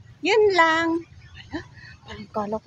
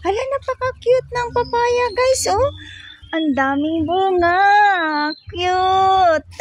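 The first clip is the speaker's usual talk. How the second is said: much higher, squealing with delight; 6 semitones higher, slower than usual. Real, not acted. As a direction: gush over something cute. Delighted at cute papaya.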